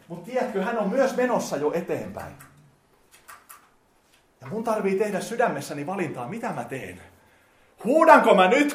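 kovaääninen, kiihtyvä saarnaäänellä; saarnaava. Acted. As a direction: nosta saarna täyteen voimaan. Preacher builds to full force. (voimakas saarnaääni)

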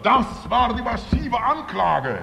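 high, loud, slow proclamation; full-force preaching. Real, not acted. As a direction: hammer out the charge from the pulpit. Preacher at climax. (mit voller Wucht langsam gepredigt)

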